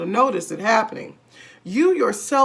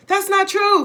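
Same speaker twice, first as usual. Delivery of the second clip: high, loud protest; indignant cry; 11 semitones higher, 6 dB louder than usual. A narrator's reading. Wife crying out against husband's claim. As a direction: cry it out in denial. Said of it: "cried Missus Twit".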